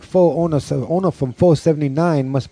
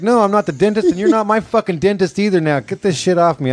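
higher-pitched, worked-up quote; mock indignation, pitched up; retold angry wife; 9 semitones higher than usual. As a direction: imitate an exasperated wife snapping back. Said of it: "She's like".

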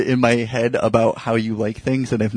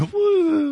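high playful "woo"; imitated swirl noise. Comedian sounding out the proposed swirl button, playful high woo. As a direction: whirl a high-pitched woo.

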